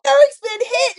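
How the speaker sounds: high and loud, shocked by the news; alarmed gasp. Retold or imitated. Brooke reading the text about Eric, loud and alarmed. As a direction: blurt it out in sudden alarm. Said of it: "and she's like".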